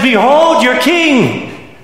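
very slow, high, ringing announcement; booming heavenly herald; archangel's proclamation. Acted. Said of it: "the voice of the archangel".

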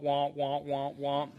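muffled trombone-like womp womp imitation; Charlie Brown teacher womp. Preacher mocking how hateful preachers sound, womp imitation. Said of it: "the ones that womp".

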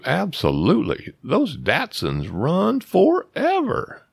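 high, drawn-out, emphatic assurance; confident endorsement. Retold or imitated. Telling Wes his car will last, overconfident. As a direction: oversell it with swooping high pitch.

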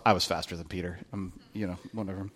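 a soft, low teasing boast; lightly mock the disciple's brag. Pastor teasing John's boast, soft mocking voice. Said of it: "he's kinda writing about himself".

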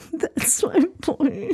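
high, slow, teary proud voice; crying, worn out. Shown as a demonstration. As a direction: stretch it like you're about to cry. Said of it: "may sound like you're crying".

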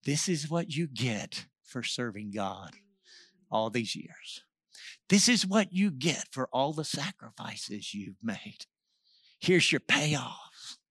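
very quiet, low, slow insinuation; the devil whispering. Retold or imitated. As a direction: murmur it slowly and low, like a tempter at the ear. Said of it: "he began to whisper to our ears".

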